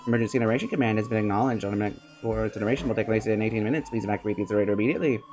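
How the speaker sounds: automated announcement. Acted. Incinerator system warns of countdown, automated and brisk. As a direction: rattle it off like an emergency recording.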